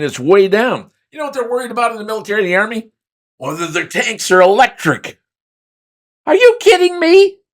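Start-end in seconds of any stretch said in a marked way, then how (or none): 6.2-7.4 high, loud disbelieving squeal; mock-shocked high cry; incredulous outburst. Real, not acted. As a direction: yelp in disbelief at something absurd. Outraged at electric tanks.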